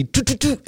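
high, soft beeps imitating red dot sights; storyteller mimicking laser sights on him, high beeps; mock beeping.

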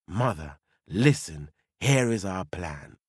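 put-on low, steady young woman; low daughter voice. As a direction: speak low to her mother.

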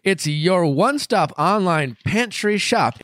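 upbeat sponsor read. Acted. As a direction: pitch the product with a grin.

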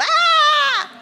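a sudden high, loud fake scream; mock scream. Describing scary clowns, a high, loud mock scream. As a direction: shriek as if startled by a scary clown. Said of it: "they're so fucking scary, you just".

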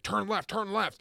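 high, repeated yell; imitated frantic drunken order; urgent demand. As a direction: cry it out while grabbing for the wheel. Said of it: "He leans forward, tries to grab".

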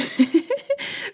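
a high, real laugh breaking out; high burst of laughter. Real, not acted. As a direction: laugh at your own hunger. Laughing about missing dinner.

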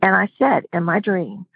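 normal talking voice

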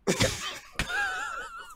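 very high breathy squeal of laughter. Real, not acted. Ranting about the ice.

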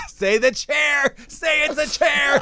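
high, slow, laughing squeal; laughing plea. Real, not acted. Pleading with friend through high laughter to say chair.

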